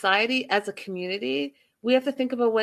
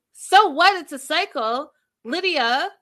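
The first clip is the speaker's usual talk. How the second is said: much higher, louder disbelieving repeat; incredulous echo; 7 semitones higher, 5 dB louder than usual. Real, not acted. Host challenging Lydia over her shrug at the cycle, incredulous. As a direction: throw it back, pitched way up in outrage.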